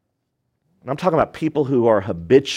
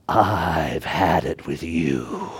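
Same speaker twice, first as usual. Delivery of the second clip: slow, low, weary parent warning; fed-up parent voice; 9 semitones lower, much slower than usual. Retold or imitated. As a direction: drag it out like a tired parent who has had enough. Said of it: "In that voice".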